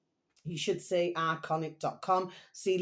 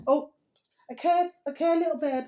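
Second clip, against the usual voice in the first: high sudden coo, a bit louder; cooing at cat; 8 semitones higher, 4 dB louder than usual. Real, not acted. Owner turning to her cat, high cooing. Cat interrupts, she coos.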